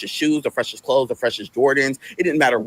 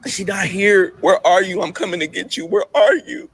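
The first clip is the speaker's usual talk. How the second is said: distraught pleading; 9 semitones higher than usual. Real, not acted. Emotional retelling of grief.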